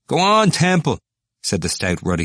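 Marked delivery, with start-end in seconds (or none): 0.1-1.0 high, loud egging on; a drinker goading the speaker; hearty urging. Acted. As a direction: cheer him on. Ruddy student urging.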